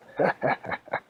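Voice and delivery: loud cackle in bursts; loud staccato villain-style laugh; triumphant wizard laugh. Acted. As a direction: cackle victoriously.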